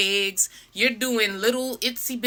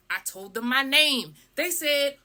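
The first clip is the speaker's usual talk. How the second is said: normal talking voice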